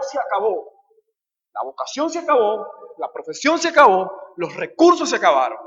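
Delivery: loud, emphatic sermon delivery; impassioned preaching. Real, not acted. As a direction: preach it forcefully, hammering each phrase. Preacher drives the point home.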